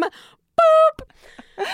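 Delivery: very high boop, drawn out; a whiny toy-robot beep; high beep noise. Pretend. Pushing a robot's buttons.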